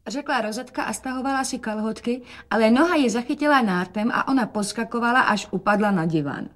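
ordinary delivery, nothing marked

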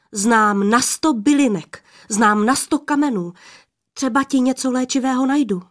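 loud, eager lad rising in pitch; crying out. A narrator's reading. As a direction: call it out keenly, pushing to convince. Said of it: "křikl mládenec". (hlasité, horlivé zvolání)

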